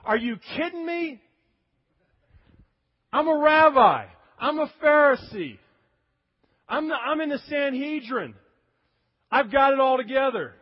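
high, loud outraged protest, mostly on rabbi and Pharisee; a proud religious teacher scoffing at being called a sinner; indignant Pharisee. Retold or imitated. Nicodemus protesting to Jesus in indignant outrage. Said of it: "and he's like".

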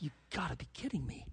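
hushed, fast mutter of disbelief; quiet "you can't be serious"; stunned disbelief. Retold or imitated. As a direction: mutter it under your breath like a stunned Pilate. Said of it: "I think Pilate was like".

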